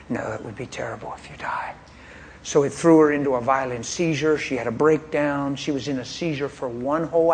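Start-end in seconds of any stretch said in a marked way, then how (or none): 0.1-1.7 whispered, pleading with her softly; hushed plea. Retold or imitated. Husband pleading with suicidal wife.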